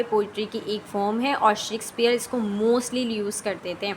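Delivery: ordinary talk, nothing marked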